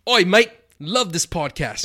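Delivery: put-on loud, high-pitched hammy Aussie accent; Australian accent. Host reading a listener's review aloud, hammed-up Australian accent. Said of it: "in my best Australian accent".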